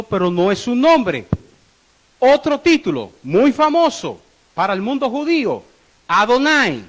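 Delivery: loud, forceful sermon delivery; emphatic preaching. Real, not acted. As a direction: proclaim each title to the congregation, loudly. Preaches divine titles at full force.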